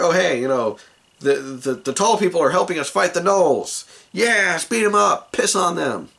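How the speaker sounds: high, loud eager rabble; excited goblins. Acted. Goblins think tall people are helping them fight, excited. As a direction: egg the mob on, gleefully rowdy. Goblins cheering their new helpers.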